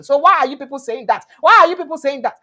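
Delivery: mock-outraged questioning; indignant challenge. Acting out people protesting to speaker.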